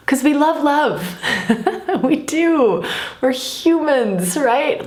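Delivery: high, lilting delivery with a laugh; amused affirmation. Real, not acted. Podcast host affirming that we humans love love, amused. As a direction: laugh lightly through it, pitch lifted well up.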